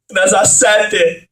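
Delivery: ordinary talk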